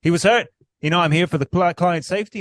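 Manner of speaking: a hint of the ref's prim excuse; mocking the referee who says he's protecting the fighter; imitating the ref. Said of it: "It's just like".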